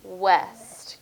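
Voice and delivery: "west" set apart as the second beat; syllable by syllable. Shown as a demonstration. Teacher counting syllables with a pupil, "west" separated. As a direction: say "west" alone, drawn out after a pause. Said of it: "Let's count the syllables".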